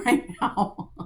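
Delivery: laughing through real anger; a short helpless laugh at her own fury; angry laugh. Real, not acted. Venting to her viewers, laughing while angry. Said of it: "I'm so angry".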